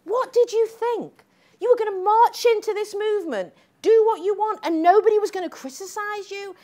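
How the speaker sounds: incredulous telling-off; scolding. Retold or imitated. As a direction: chide her for expecting no criticism. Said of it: "I said to her".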